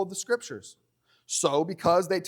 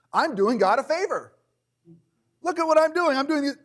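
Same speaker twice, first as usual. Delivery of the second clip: higher, louder mock-proud sinner; boastful sinner; 10 semitones higher, 4 dB louder than usual. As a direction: brag like someone proud of sinning.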